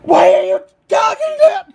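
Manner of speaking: high, angry shouted questions; furious, exasperated demands; yelling. Real, not acted. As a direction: yell each question, slowing hard on the last. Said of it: "Because you keep yelling at me".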